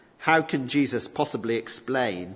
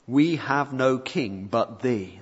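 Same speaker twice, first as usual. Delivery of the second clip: slow solemn prayer quote; slower than usual. Acted. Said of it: "meal declares this".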